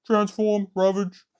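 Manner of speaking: short, loud, high robot commands; clipped robot orders, high and loud; Soundwave voice. Retold or imitated. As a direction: bark it like a cartoon robot. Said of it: "short, loud speech".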